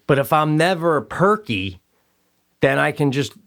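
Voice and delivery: normal talking voice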